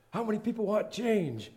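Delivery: high, loud preacher call to the congregation; cartoon preacher. Cartoon preacher asks the congregation, rousing preacher voice. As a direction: boom it out like a pulpit preacher. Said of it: "a preacher preaching from the thing".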